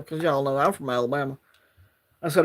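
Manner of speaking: usual speaking voice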